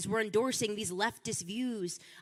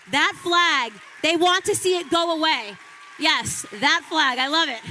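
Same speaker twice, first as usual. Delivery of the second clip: louder, higher rally cry; impassioned shout; 4 semitones higher, 6 dB louder than usual. Real, not acted. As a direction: fire up the audience. Rallying crowd about the flag.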